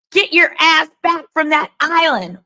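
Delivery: loud, high-pitched mock demand; demanding text read-out. Host reads out a text ordering someone back from an island, loud demand. Reading an angry text message aloud.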